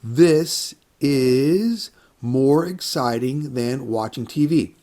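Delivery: drawn out and carefully spaced; slow model sentence. Shown as a demonstration. Teacher giving a sample comparative sentence, slow and clear. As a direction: say it slowly so learners can follow. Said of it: "Can you think of a comparative sentence?".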